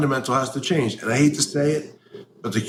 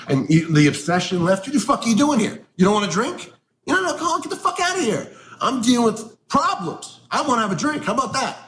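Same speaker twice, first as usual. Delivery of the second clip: sarcastic rant, pitched much higher; mocking the old timers, voice climbing higher and higher; mock angry rant; 10 semitones higher than usual. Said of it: "And I would get so angry".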